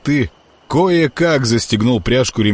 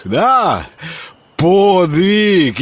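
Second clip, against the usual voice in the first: put-on louder, pitched-up drunk insistence; drunken emphatic; 10 semitones higher, 4 dB louder, much slower than usual. A narrator's reading. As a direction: hammer it home, drunk. Drunk Grekhvost repeats louder.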